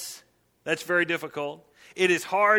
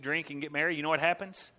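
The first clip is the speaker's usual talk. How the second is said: ordinary talk, nothing marked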